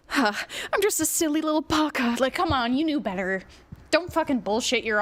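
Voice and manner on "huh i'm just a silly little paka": higher, far-off mocking squeak of feigned innocence; a hammed-up naive little voice; mock innocent voice. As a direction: play dumb and cutesy to mock them. Mocking the apologizer's excuse.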